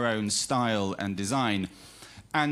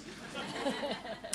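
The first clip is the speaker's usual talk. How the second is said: breathy laugh; 13 semitones higher, 11 dB quieter than usual. Real, not acted. Joke about parents.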